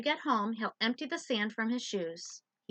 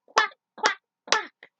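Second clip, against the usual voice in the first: high, loud furious quacks; angry daddy duck quack; 14 semitones higher, 14 dB louder, much slower than usual. Acted. Mad daddy duck quacking at the lost ducklings, loud. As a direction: squawk it out like a cross father duck. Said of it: "Dad was mad".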